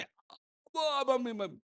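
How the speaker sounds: high, soft, drawn-out mock whine; child whimpering to mummy to skip school; fake pain. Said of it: "దొంగ నొప్పిది". (దొంగ నొప్పితో మూలుగు)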